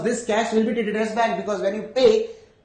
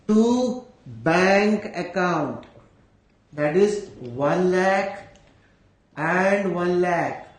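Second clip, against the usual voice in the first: dictating slowly, word by word; slow dictation; much slower than usual. Shown as a demonstration. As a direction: spell it out slowly for the class. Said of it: "teacher dictating entry slowly".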